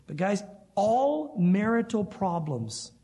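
slow, drawn-out "all marital problems"; slow and deliberate, "all" weighted; stress on all. Shown as a demonstration. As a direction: lean hard on "all". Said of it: "I want to emphasize the word all".

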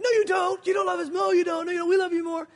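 high, loud childish protest, imitating his girls; mock-angry daughters. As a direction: play the indignant kids shouting back. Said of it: "it makes them mad".

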